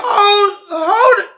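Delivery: loud, high mock shock; shocked outburst. Man reading his girl's cheating email, shocked outburst. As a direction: act out a stunned yell.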